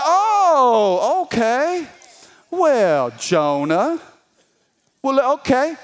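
high, drawn-out "oh", then teasing concession; mock surprise. God answering Jonah's no, mock surprised. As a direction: act taken aback, pitched high.

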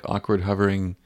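usual speaking voice

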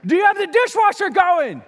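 loud high shout; yelling through the house to his wife, loud and high. Retold or imitated. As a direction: bellow it down the hall. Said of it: "I yelled".

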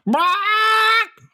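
very high, piercing squawk; parrot screech. A narrator's reading. Parrot screams into dad's face, high squawk. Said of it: "ja, nærmest skriger".

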